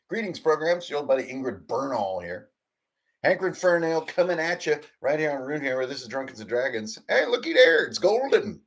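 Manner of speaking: loud, fast announcer welcome; hammy show host. Acted. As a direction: pitch it like a wacky TV host.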